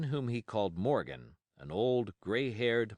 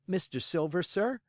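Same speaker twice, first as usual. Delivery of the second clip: put-on boyish, higher, eager question; lightly voiced cabin boy, rising up; young Jim asking; 9 semitones higher, 3 dB louder than usual. Boy Jim asking the landlord, eager and high. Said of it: "I asked".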